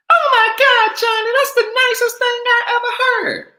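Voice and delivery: put-on very high, loud, gushing girl voice; swooning woman. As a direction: melt over the compliment, squealing. Woman swooning at pickup line.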